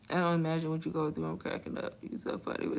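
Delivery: breathy whisper, reading comments aloud. Real, not acted. Bank worker whispers viewer comments to herself.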